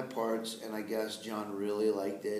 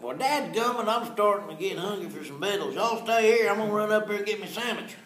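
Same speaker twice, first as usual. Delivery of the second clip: Mater's Southern twang; 10 semitones higher, 7 dB louder than usual. Shown as a demonstration. Actor shows how Mater says it, loud Southern. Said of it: "It was like".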